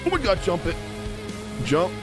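normal talking voice

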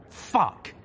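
high, loud outburst; alarmed curse. A narrator's reading. Narrator realizes Red Eye is dying, alarmed curse.

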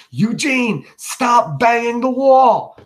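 high, slow, drawn-out call across the yard; mother calling her son in from the yard, high and slow; mother yelling. Acted. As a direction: stretch it out, calling to the boy outside. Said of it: "She yells out".

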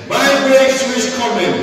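high, loud "My breakthrough is coming" with full conviction; the preacher demands a bolder repeat of "My breakthrough is coming"; loud declaration. Shown as a demonstration. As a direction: shout "My breakthrough is coming" like you truly believe it. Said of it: "Never say it like you know me. Make again".

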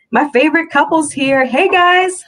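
high, bubbly sister bursting in; excited greeting. A narrator's reading. Sister bounces in greeting couple.

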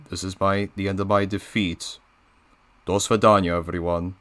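a beaten Russian heavy sighing; Diesel 10 Russian accent. Acted. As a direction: glum defeated farewell. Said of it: "my Diesel ten voice impression".

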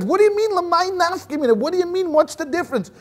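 high-pitched, exasperated outburst from the rabbi; a rabbi at the end of his patience, voice up high. Retold or imitated. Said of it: "when he was exasperated".

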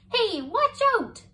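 high, loud warning shriek; the moth shrieking at the astronaut, high and loud. Acted. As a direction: yell to dodge the danger. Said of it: "shrieked Molly".

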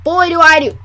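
ordinary talk, nothing marked

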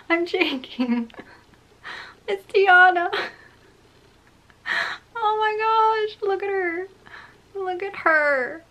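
high, loud, drawn-out squeal of delight; shaking with excitement. Real, not acted. Collector showing off Tiana to viewers, shaking with excitement. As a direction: stretch the words out, squealing in awe.

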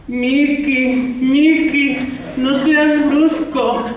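voz de chica algo más aguda, lenta; imitando a Linda. Retold or imitated. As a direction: finge ser una chica enamorada. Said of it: "ella te susurrará". (imitación ligera de una chica susurrando)

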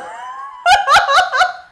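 very high, squealing laugh; loud squeal of giggles. Real, not acted. Laughs between greetings.

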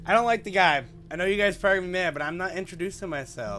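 loud, fed-up outburst; annoyed. Real, not acted. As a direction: loudly declare you won't do it. Streamer annoyed at rude villager.

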